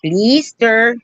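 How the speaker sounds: high mock-Spanish "Blister"; hammed-up Spanish accent. Shown as a demonstration. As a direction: say "Blister" as "BLEE-ster", fake Spanish. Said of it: "how they say that in Spanish".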